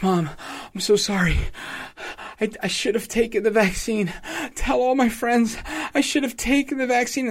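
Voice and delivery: high, gasping young woman impression; hammed-up out-of-breath pleading, high-pitched; mock breathless patient. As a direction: imitate a sick daughter panting out regrets. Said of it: "she's all out of breath".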